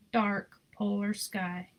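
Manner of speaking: usual speaking voice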